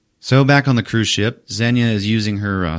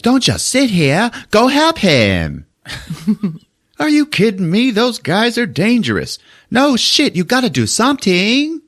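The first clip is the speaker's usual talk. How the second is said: urgent woman; 14 semitones higher than usual. Retold or imitated. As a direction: push him to go help, then argue back. Layla urging Treat, argument.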